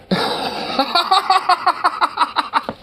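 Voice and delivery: long, high cackling laugh; helpless laughing fit; incredulous laughter. Real, not acted. Streamer amused at someone called a Yankee over pop, long high laughter.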